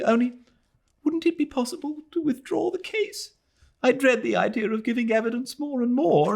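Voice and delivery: put-on high, quick, anxious girl's voice; plead, then apologise in a rush; fiancée begging to drop the case; fretful young woman. A narrator's reading. Adela pleads to withdraw.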